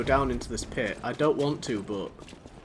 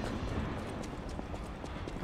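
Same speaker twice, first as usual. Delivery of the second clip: sharp gasp; 16 dB quieter than usual. Real, not acted. Finding the shortcut.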